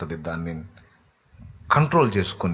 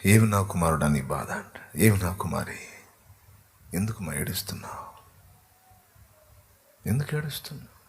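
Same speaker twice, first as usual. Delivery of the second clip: put-on a loving father bending down to a crying child; tender voice of God; 3 semitones lower, 5 dB quieter than usual. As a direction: lower and softer, gently asking why you weep. Preacher voices God consoling the believer.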